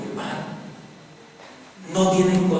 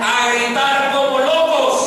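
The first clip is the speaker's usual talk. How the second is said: gritos de pánico; 10 semitones higher, 7 dB louder, slower than usual. Acted. Predica los gritos de los discípulos.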